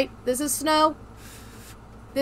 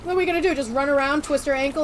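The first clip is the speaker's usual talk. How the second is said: ordinary talk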